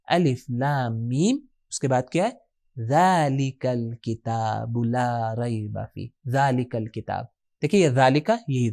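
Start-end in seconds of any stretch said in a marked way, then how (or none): 0.1-1.4 slow, drawn-out recitation; measured, stretched syllables; Quran recitation. Shown as a demonstration. Reciting Quran verse to class. 2.9-6.1 very slow, low, soft recitation; hushed, stretched verse reading; Quran recitation. Shown as a demonstration. Reciting the verse, then repeating it.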